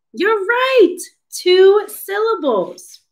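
high excited you're right; excited praise. Real, not acted. Teacher praises child.

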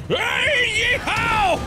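high, drawn-out yeehaw holler; cowboy whoop. Pretend. Gamer playing a little guy, cowboy whoop.

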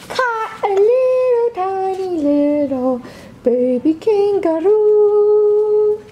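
high, slow cooing over the tiny kangaroo. Real, not acted. Mom showing baby his toy kangaroo, slow high coo. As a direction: melt over it like it's precious.

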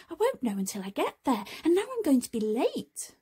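fretting Harry. Acted. Harry tells Tom he'll be late, hurried and fretting. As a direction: rush it out, worried about being late.

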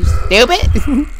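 usual speaking voice

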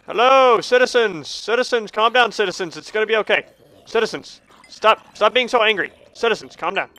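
high-pitched pleading ruler; addressing citizens. Pretend. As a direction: placate an angry crowd from above. Pleads with angry game creatures.

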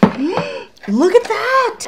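high, loud, drawn-out squeal of amazement; gasp, then squeal it out slowly; excited gasp. Real, not acted. Excited reaction to the can.

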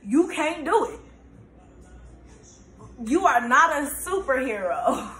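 high, punched-out retold telling-off; emphatic and clear. Grandpa telling her she can't save everyone, emphatic. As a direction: make every word land, imitating grandpa. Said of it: "he made sure it was clear".

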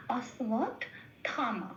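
"অশ্বত্থামা" as ash-wat, thama; wa sound. Shown as a demonstration. Teacher showing the whole name, "অশ্বত্থামা" as ash-wat-thama. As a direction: split "অশ্বত্থামা" after a w-glide wat, then thama. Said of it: "বটাকে উচ্চারণ করতে হবে ও plus অ বলে".

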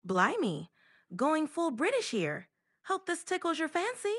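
very British, lilting accent; full British accent. Shown as a demonstration. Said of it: "Even more British".

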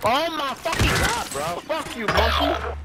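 frantic outburst. Real, not acted. Frantic reaction during firefight.

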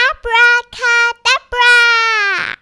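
loud, high, slow incantation; ringing, drawn-out spell cry; shouted spell. A narrator's reading. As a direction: call out the magic word, holding the last syllable. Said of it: "and shouted".

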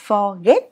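"forget" with "get" jumping up short; Vietnamese intonation. Shown as a demonstration. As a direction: flick the end of "forget" upward. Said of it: "Tiếng Việt mình sẽ đọc". (đọc "forget" vút lên kiểu Việt)

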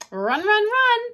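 rising, teasing call, climbing high. Acted. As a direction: taunt your chasers in a storybook voice. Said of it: "laughed and called out".

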